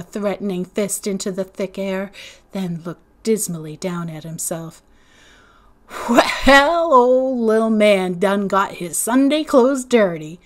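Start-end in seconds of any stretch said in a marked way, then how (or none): 6.1-10.4 loud, mocking teasing voice; laughing taunt. T J jeering at little man's dirty clothes, mocking. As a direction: needle him, amused at his mess. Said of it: "T J laughed".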